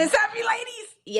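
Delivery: very high, gleeful call-out; excited interjection. Real, not acted. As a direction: jump in with a high-pitched plug. Guest jumps in with forgotten group.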